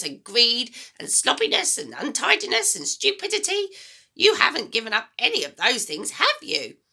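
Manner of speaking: put-on high, loud, bossy old woman; a mean grandma giving orders, high and loud; grumpy grandma voice. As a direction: nag the boy like a sour old granny. Grandma lectures.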